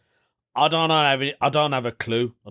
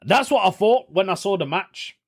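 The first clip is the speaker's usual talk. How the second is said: normal talking voice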